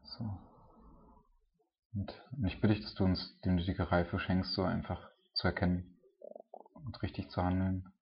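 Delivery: earnest, mostly hushed prayer addressed to God; spoken prayer. Acted. Preacher leads the congregation in closing prayer, earnest and hushed.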